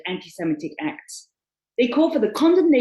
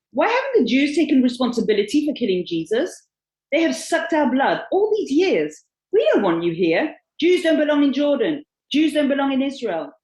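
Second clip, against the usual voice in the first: loud, emphatic recited accusations; hard-hitting quoted tirade, short of screaming; retold angry tirade; 4 semitones higher, 5 dB louder than usual. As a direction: read the attackers' words with raised force. Reads out attackers' continuing verbal assault.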